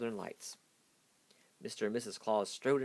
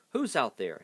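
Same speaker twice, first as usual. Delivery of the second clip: loud call through the door; gruff shout; 3 semitones higher, 7 dB louder than usual. A narrator's reading. Stormella shouting at whoever knocked, gruff. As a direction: bark it from inside the castle. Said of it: "shouted a gruff voice".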